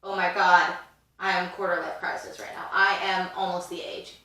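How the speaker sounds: usual speaking voice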